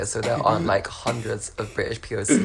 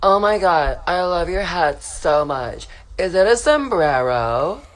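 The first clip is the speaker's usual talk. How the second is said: put-on drunk girl; 7 semitones higher, 5 dB louder, slower than usual. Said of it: "and she's like".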